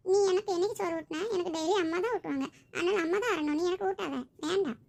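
put-on sulky child. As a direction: whine it like a stubborn small child.

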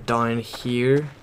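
usual speaking voice

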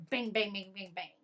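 mock knocking, each bang stamped out; imitated door banging. Retelling the boyfriend pounding on the door, banging sounds. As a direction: bang like a fist on the front door.

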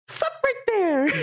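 stretched, high-pitched hero shout; Mario voice. Acted. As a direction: call a halt in a high character voice. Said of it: "Let me try out my Mario voice".

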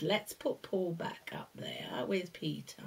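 soft whispered aside; whispering. Real, not acted.

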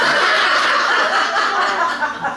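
loud, breathless burst of laughter; cracking up mid-reading; real laugh. Real, not acted. Reader breaking up at the scene, loud real laughter.

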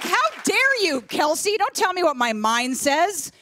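high, indignant mock outrage; hammed-up offended comeback. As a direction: play it affronted. Imagined outraged retort.

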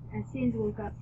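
usual speaking voice

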